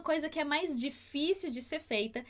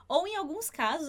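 usual speaking voice

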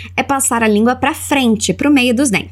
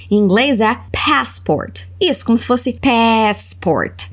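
slow clear model; slower than usual. Shown as a demonstration. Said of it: "Passaporte é bem parecido".

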